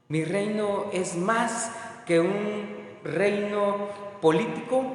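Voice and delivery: soft, hushed reassuring Jesus voice; calm Jesus. Acted. Preacher voicing Jesus to calm Peter, soft and hushed. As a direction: speak low and gently, calming Peter.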